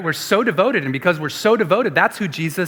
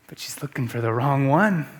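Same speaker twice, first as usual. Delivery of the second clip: ordinary talk, nothing marked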